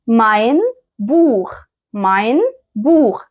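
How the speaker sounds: "ماين بوخ" slowly as mein Buch; careful German model. Shown as a demonstration. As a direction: separate "ماين" and throaty "بوخ" clearly. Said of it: "هقول".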